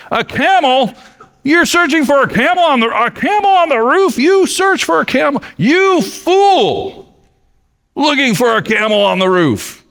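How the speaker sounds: high, incredulous indignant outburst; indignant king. Acted. King scolds the shaman searching on his roof, high indignant outburst. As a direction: scoff at the fool on the roof. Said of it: "King Ibrahim was indignant".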